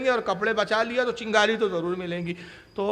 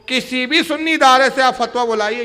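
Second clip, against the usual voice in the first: loud, raised challenge to the crowd; forceful challenge; 4 semitones higher, 8 dB louder than usual. Real, not acted. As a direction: dare them from the pulpit. Preacher's challenge to audience. (بلند آواز میں للکارتے ہوئے)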